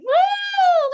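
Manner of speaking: high, loud woo; celebratory whoop. Shown as a demonstration. Teacher shows how to celebrate with a whoop. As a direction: whoop like you just won. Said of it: "just celebrated".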